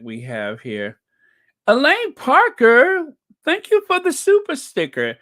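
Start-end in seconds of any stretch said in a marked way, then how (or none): 1.7-5.2 high and loud, thrilled; big excited shout-out; excited thanks. Real, not acted. Thanks a super sticker excitedly.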